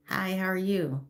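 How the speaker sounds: normal talking voice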